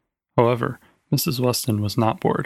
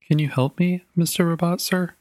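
lightly voiced child, pitched higher; polite little girl; 6 semitones higher than usual. A narrator's reading. Gloria asks the talking robot for help, politely in a higher child's voice. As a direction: ask like a hopeful, courteous child.

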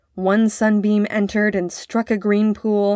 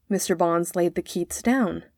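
ordinary delivery

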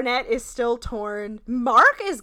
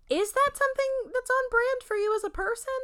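mock questioning. Mocking her own statement.

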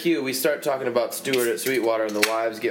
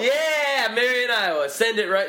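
hyped-up call to fans; 10 semitones higher than usual. Pretend. Rallying fans to send cardboard.

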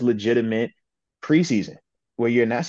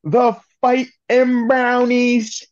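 mocking announcer voice; 12 semitones higher, 5 dB louder, slower than usual. Mocking the rival team's name.

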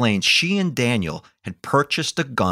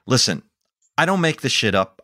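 ordinary talk

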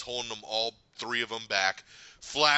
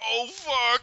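terrified guard; 13 semitones higher, 7 dB louder than usual. Retold or imitated. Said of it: "guard looks at Wolverine like".

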